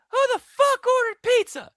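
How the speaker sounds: high, drawn-out screech; yelled retort. Retold or imitated. Customer yelling at the delivery guy, high indignant yell. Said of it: "I got yelled at".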